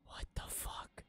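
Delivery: hushed, voiceless disbelief; stunned whisper. Real, not acted. Viewer watching the directing in awe, stunned whisper.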